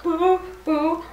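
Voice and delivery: high, playful boo-hoo wail; mock crying. A narrator's reading. Teacher acts out Bobo's boo-boo for children, mock crying. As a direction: wail like a hurt little brother, hammed up.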